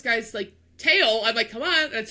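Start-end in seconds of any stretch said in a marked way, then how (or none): 1.5-1.9 impatient. Retold or imitated. Tailgating slow roller, impatient.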